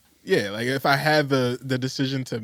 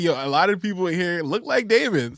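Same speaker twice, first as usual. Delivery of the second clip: retold amazement; 6 semitones higher, 3 dB louder than usual. Retells noticing the lookalikes.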